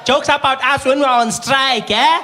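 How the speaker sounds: high, slow mocking laughter. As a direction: laugh at him knowingly. Clerk gloating with laughter.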